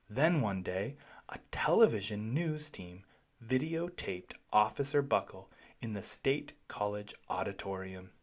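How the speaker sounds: ordinary delivery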